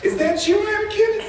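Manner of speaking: put-on very high, innocent child voice; hammed-up small-child question; little kids. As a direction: squeak it like a trusting youngster. Voicing little kids in film.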